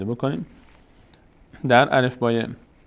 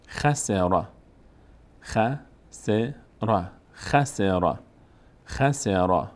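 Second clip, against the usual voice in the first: "خسر" as one word, kha-se-ra; joined up; much slower than usual. Shown as a demonstration. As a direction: blend the syllables of "خسر". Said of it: "بعد پشت سر هم". (پشت سر هم)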